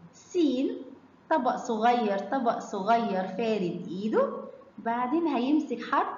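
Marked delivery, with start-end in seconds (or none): none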